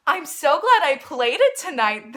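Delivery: loud, swooping delight; delighted reply. Retold or imitated. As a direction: act out a thrilled, emphatic reply. Singer's glad reply acted out.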